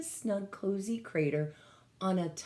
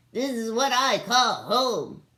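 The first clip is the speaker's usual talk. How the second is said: louder, higher, delighted alien; thrilled; 5 semitones higher, 9 dB louder than usual. Acted. Gork claims the ring as home, loud and delighted. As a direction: crow it with joy.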